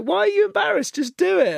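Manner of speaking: high squeaky imitation of his partner; egging him on in a high mock voice; mock Izzy, high. Partner urging him to perform, imitated in a high voice. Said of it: "she's like".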